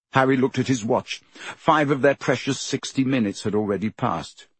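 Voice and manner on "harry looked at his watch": ordinary delivery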